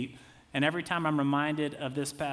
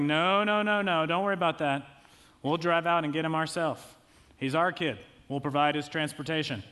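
loud, hurried no-no of polite self-reliance; stubborn refusal; 4 dB louder than usual. Retold or imitated. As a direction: brush off the offer, insisting loudly. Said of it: "our default is to be like".

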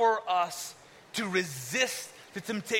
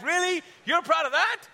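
incredulous; 7 semitones higher, 4 dB louder than usual. Acted. Mock doubter questioning God.

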